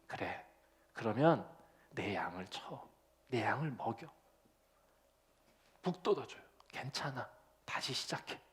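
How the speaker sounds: soft, near-whispered encouragement; encouraging. Retold or imitated. Jesus encouraging Peter to start again, hushed. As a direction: lift him up quietly and kindly. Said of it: "라고 얘기해 주시는 거지".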